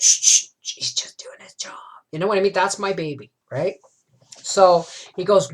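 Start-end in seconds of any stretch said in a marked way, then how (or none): 0.0-1.8 shushing her son in a whisper; calm him down with a hush. Retold or imitated. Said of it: "I said".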